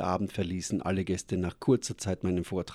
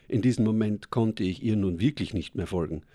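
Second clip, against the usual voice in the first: usual speaking voice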